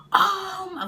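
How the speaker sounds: loud, high-pitched outburst; imitating an angry yell; retold yelling. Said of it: "So she's yelling".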